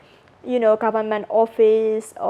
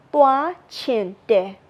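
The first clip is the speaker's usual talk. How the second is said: 1 slow demo; much slower than usual. Shown as a demonstration. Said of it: "So it will be".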